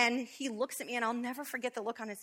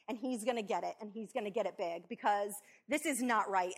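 usual speaking voice

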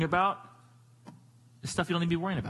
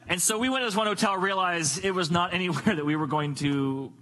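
ordinary talk

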